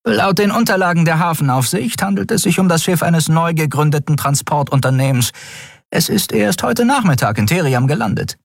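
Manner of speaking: high-voiced officer briskly reporting to the admiral; urgent, high report to the commanding officer; first officer reporting. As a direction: pitch her voice up as a crisp officer giving a report. Shia reads out the ship records.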